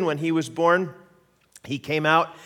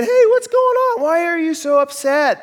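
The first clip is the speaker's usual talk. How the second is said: much higher, louder sing-song voice to a newborn; baby talk; 8 dB louder than usual. Retold or imitated. New father soothing his screaming son, high baby talk. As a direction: coo loudly and high at a crying baby.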